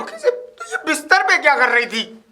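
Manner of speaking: high, loud angry echo; incredulous retort. Acted. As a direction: throw the excuse back in disbelief.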